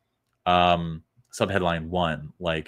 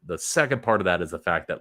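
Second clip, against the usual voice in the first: usual speaking voice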